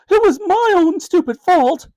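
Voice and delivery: high and loud, crying out the blame; a mother weeping at her own fault; through tears. Acted. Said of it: "added Mum through her tears".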